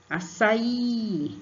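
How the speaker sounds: "Açaí" with the last syllable "í" stressed and drawn out; teacher models "Açaí" with stress on "í". Shown as a demonstration. Teacher modelling the stressed "í" for children. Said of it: "vai ser acentuado a sílaba forte".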